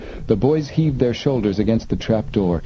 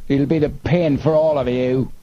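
cutting threat in a raised pitch; Snapman; 6 semitones higher than usual. A narrator's reading. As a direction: threaten your men across the dark. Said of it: "Snapman's voice cut through the night".

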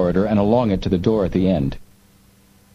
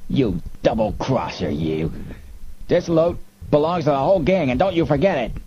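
ugly-tempered gangster, swinging much higher; rough arguing crook; 6 semitones higher than usual. A narrator's reading. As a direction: argue like a double-crossed thug. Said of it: "rough, arguing voices below them".

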